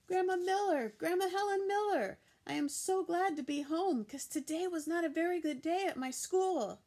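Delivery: high, loud calling through the house; child hollering for grandma on coming home. A narrator's reading. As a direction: yell for grandma from the door. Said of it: "hollered for my Grandma".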